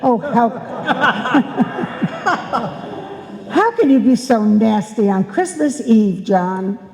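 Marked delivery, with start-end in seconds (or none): 0.5-3.4 exasperated laugh. Acted.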